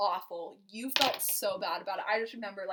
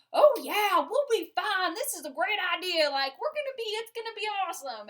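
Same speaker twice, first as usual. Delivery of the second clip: much higher, louder, cocky reassurance; imitating Slade; 10 semitones higher, 8 dB louder than usual. Telling her husband how he brushed off her doubts, mock cocky voice. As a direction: ham up an overconfident guy. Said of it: "And you're like".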